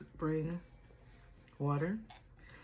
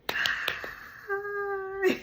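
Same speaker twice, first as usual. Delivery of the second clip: much higher, bright chirpy greeting; squeaky high hi; 5 dB louder than usual. Acted. Greeting her viewers in a squeaky high voice.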